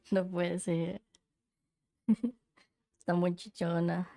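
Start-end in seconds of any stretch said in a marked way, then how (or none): 0.0-2.4 slow words through laughter; laughing. Real, not acted. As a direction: laugh out the disbelief. Laughs at stone figure.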